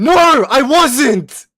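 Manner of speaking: slow, high, yelled no; indignant denial. Real, not acted. Streamer answering chat accusation, yelling indignantly.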